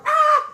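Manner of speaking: high scream. Acted. Pharaoh chased by the tiger, shrieking high.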